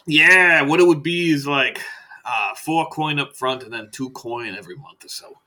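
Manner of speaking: loud opening, easing down into the figures; lightly voiced doctor quoting casually; chatty salesman doctor. Doctor giving patient the price, breezy.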